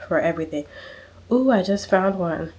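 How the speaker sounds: ordinary talk, nothing marked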